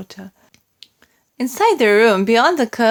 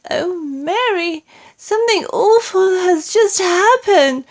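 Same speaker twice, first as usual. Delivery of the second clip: upset queen; 11 semitones higher, 4 dB louder than usual. A narrator's reading. Queen woken from a nightmare.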